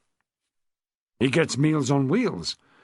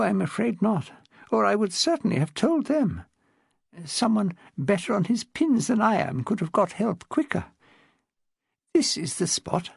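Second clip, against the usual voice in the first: put-on higher, refined elderly upper-class voice; posh old lady; 6 semitones higher than usual. Old lady tells Rose about her husband, posh elderly voice. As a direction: speak like a frail, well-bred widow of seventy.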